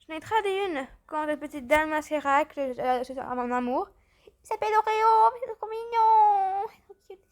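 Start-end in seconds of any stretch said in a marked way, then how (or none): 4.2-6.7 high, loud, slow cooing over a cute pet; gushing over a pet. Real, not acted. Gushing over her new pet. 6.7-7.2 breathy gush. Real, not acted. Gushing over her new pet.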